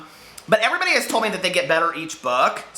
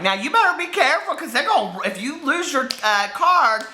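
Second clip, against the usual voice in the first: high, sassy warning voice; saucy lady; 7 semitones higher than usual. Retold or imitated. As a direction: play the sassy lady wagging a finger. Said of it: "she was real saucy".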